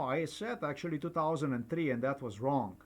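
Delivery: ordinary talk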